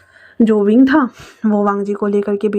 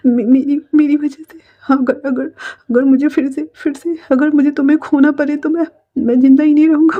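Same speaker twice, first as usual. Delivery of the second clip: high, broken, stammering sobbing plea; acted weeping confession, raised in pitch; tearful pleading; 4 semitones higher than usual. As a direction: beg forgiveness through tears, catching on repeated words. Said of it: "वांग जी रोते रोते बोल रहा".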